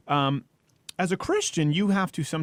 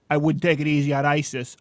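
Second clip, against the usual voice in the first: mock tough-guy shout; 3 dB louder than usual. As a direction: ham up a tough-guy boast. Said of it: "Rubio talking about".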